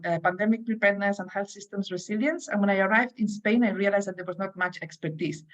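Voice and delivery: usual speaking voice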